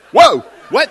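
loud, high yelp of disbelief; freaked-out shock. Retold or imitated. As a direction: imitate a stunned, loud double take. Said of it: "that freaked them out".

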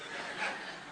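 a breathy laugh under the story; amused chuckle mid-anecdote; soft unvoiced chuckle. Real, not acted. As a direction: let a quiet laugh slip out. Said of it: "teller laughing at story".

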